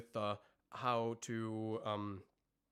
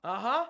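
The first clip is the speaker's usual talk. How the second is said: short raised scream; brief scream demo; 7 semitones higher, 8 dB louder than usual. Shown as a demonstration. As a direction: slip into a quick high scream. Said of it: "I went into the scream".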